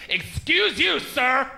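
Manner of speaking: high, affronted mock-offended woman; indignant confrontation. Offended woman confronting the comic over a joke, indignant. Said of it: "gets in my face and goes".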